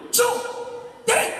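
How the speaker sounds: ordinary talk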